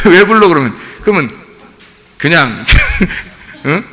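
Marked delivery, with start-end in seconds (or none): 0.1-0.5 louder, higher retort through laughter; child talking back, mimicked. 자녀 말대꾸를 웃으며 흉내. (웃음 섞인 아이 말대꾸 흉내)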